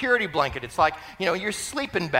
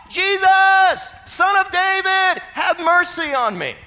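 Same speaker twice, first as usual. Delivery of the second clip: high, full-voiced cry for mercy; desperate shout; 9 dB louder, slower than usual. Blind beggar calling to Jesus over the crowd, shouting. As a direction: shout it out, stretching the name. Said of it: "he began to shout".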